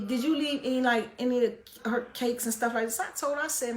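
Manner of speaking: ordinary talk, nothing marked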